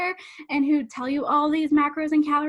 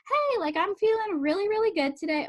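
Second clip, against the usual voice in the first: upbeat, higher-pitched mock cheer; happy tone; 6 semitones higher than usual. Health coach voicing someone enjoying food, happy tone. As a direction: put a happy, lifted tone on it. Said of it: "a happy tone on it".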